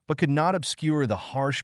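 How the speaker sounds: usual speaking voice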